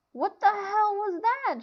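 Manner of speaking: high, slow shocked question; startled. Acted. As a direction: blurt it in shock. John startled by the boom.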